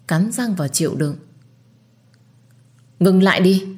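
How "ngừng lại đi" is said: loud urgent call to stop; urgent shout. Acted. Narrator stopping the servant's whipping, shouting loud. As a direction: cry out to halt the beating.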